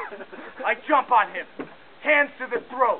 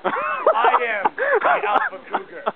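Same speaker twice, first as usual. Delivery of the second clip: high, loud, slow triumphant bellow; alpha cougar roar; 7 dB louder, much slower than usual. Acted. Crew leader proclaims himself to the cougars, slow, loud and high. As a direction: stretch it out like a battle cry. Declares dominance over cougar herd.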